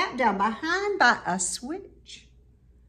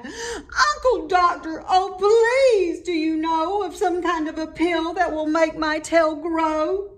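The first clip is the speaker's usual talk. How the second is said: crying plea; 6 semitones higher than usual. A narrator's reading. Little bird begs her uncle for a tail pill, high wailing plea. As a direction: beg the doctor like a wailing child. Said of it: "and she cried".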